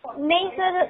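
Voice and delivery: usual speaking voice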